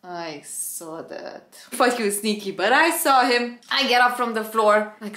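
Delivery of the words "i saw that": muttered aside. Retold or imitated. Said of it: "he's like".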